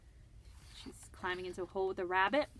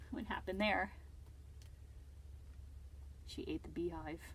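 ordinary talk, nothing marked

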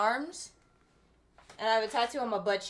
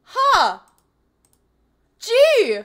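surprised; 9 semitones higher, 14 dB louder than usual. Pretend. Mock surprise at her tattoo.

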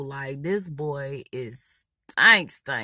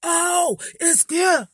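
loud, high-pitched mock-shocked exclaiming; acting stupid; 12 semitones higher, 5 dB louder, much slower than usual. Clowning loudly in the lunchroom on spotting him, acting stupid. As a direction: gasp and holler it out, clowning around. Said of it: "I go to acting stupid".

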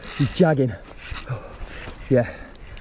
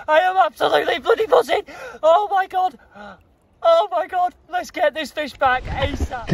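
much higher, squealing with excitement; excited; 10 semitones higher than usual. Real, not acted. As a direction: gush over the catch, can't contain it. Buzzing after the catch.

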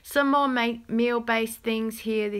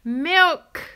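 a bright, louder, higher burst of "Milk!"; excited exclamation; 7 semitones higher, 7 dB louder than usual. Real, not acted. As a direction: call out Milk like you've just found it. Spotting milk in the shop.

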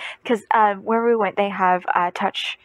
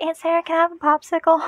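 put-on higher, fast, kid asking sweetly; eager child voice; 8 semitones higher, faster than usual. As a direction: ask like an excited little boy. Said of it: "he's like".

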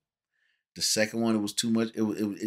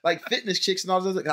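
mocking friends; 7 semitones higher than usual. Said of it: "They be like".

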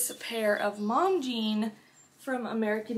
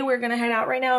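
normal talking voice

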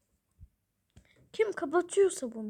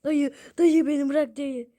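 shouted pleading, much louder; loud mock plea; 12 dB louder than usual. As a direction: yell the plea dramatically. Same plea, now shouted.

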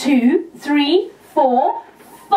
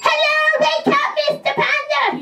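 put-on baby puppet call; 9 semitones higher than usual.

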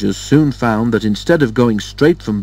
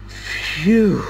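relieved phew; 8 semitones higher than usual. A narrator's reading. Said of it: "Wearily, Thomas agreed".

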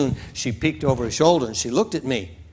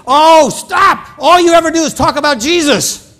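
angry outburst; 14 semitones higher, 8 dB louder than usual. Retold or imitated. Woman angrily cuts him off.

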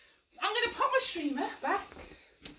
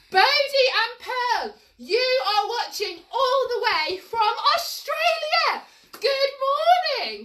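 bright sing-song hello, louder and higher; excited greeting; 6 semitones higher, 6 dB louder than usual. Real, not acted. Teacher greeting kids abroad in a sing-song hello. Greeting children watching from Australia.

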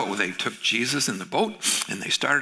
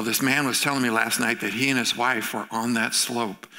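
normal talking voice

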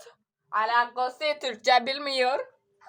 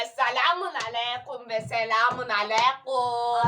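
put-on Arabic-accented greeting; 4 semitones lower than usual. Arabic greeting in Syrian role.

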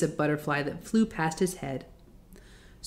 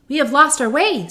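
put-on girl voice; 6 semitones higher, 5 dB louder than usual. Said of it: "said Dorothy".

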